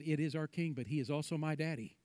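hushed, unworried child, lightly voiced; quiet little boy's reply. A narrator's reading. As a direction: answer the guards quietly and simply. Boy calmly answers yelling guards.

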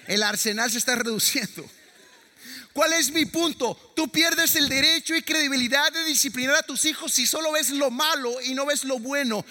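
más agudo y fuerte, lento al final; predicación a toda voz. Acted. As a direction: proclama el punto a la congregación. Predica con fuerza al público. (predicador que proclama con fuerza, alto y lento)

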